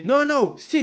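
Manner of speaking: high, firm refusal; insistent man's voice. Retold or imitated. Indian man insisting he pose. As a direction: push back hard, no room to argue.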